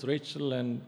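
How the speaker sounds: ordinary talk, nothing marked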